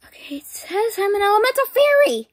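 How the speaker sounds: breathy excited whisper. Pretend. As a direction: whisper the result in awe. Reading the scanner result.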